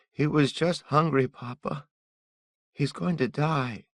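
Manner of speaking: put-on high, earnest child voice begging; a boy pleading with his father; young son begging his papa to help.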